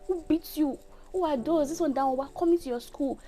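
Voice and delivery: high-pitched mimicry of a ranting mum; outraged mother. Mother's outraged rant in retold call.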